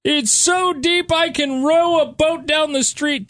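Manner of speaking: high, slow mock reporter voice; squeaky overdramatic news reporter; imitating a reporter. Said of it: "This woman's in a boat".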